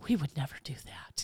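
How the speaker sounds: whispered, low and soft. Retold or imitated. Polite strangers answering her apology, whispered. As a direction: hush it like a polite secret. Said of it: "And they go".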